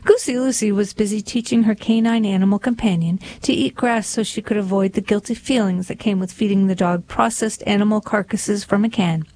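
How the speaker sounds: plain reading voice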